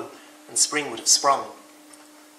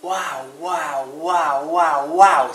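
wows building much louder; amazed Rabbit; 2 semitones higher, 8 dB louder, much slower than usual. Acted. Rabbit awed by the world, building louder wows. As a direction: swell each wow in growing wonder. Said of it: "said Rabbit".

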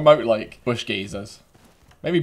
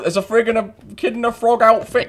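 high, raised yelling; shouted callouts; 10 semitones higher, 7 dB louder than usual. Pretend. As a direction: holler across the field at them. Keeps yelling as the chase ends.

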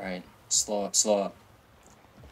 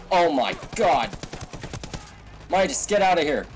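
urgent shout; 6 semitones higher, 5 dB louder than usual. Real, not acted. Teammate down, urgent orders.

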